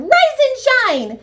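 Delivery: very high loud shouts; yelling. Acted. Camilla shouting at leaves, very high and loud. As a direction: shout the leaf awake.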